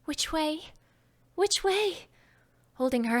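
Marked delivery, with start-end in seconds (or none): 0.0-2.1 higher, quick fretful repeat, the second rising much higher; a worried little girl checking herself; anxiously. Acted. Alice, having eaten the cake, asks herself anxiously. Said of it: "said anxiously to herself".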